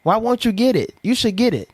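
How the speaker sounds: mildly voiced nagging mother; pushy mom. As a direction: nag him to get it. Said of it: "Couple months pass".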